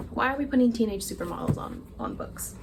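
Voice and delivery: normal talking voice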